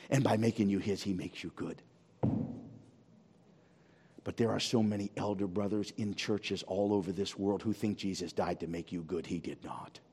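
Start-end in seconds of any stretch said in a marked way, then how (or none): none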